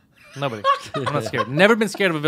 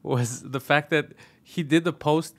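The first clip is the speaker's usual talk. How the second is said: normal talking voice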